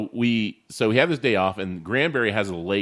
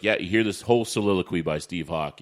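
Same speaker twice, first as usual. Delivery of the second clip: ordinary talk, nothing marked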